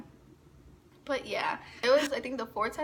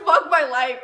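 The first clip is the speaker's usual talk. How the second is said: laughing; 2 semitones higher, 8 dB louder, slower than usual. Real, not acted. Girl laughing as she retells sitting by her crush. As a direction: laugh out the despair.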